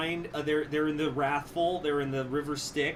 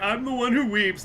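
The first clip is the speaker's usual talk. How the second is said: mock weeping; 9 semitones higher, 6 dB louder than usual. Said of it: "and he's, like, crying".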